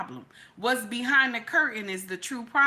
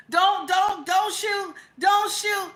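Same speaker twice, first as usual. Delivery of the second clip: higher, louder mock plea of "don't shoot"; mock pleading; 7 semitones higher, 4 dB louder, slower than usual. Citizen begging the police officer not to shoot the robber, higher and louder mock plea. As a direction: beg the cop like a panicked bystander.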